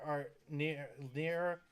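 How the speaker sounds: interrupted by a hiccup; words broken by hiccups. Real, not acted. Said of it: "excuse me, I got the hiccups".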